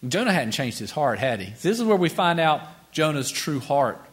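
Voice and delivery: ordinary delivery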